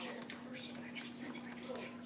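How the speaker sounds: unvoiced secret whisper; whispering a secret plan to a friend. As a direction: hush it like a plotting kid. Kids plotting in secret.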